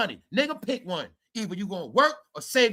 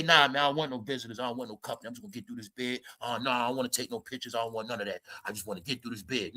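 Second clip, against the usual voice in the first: low, much softer muttered prisoner voice; mock quiet inmate; 9 semitones lower, 8 dB quieter, faster than usual. YouTuber mocking a rapper's jail talk, low and hushed. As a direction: imitate a rapper keeping his head down, low and hushed. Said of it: "You ain't say".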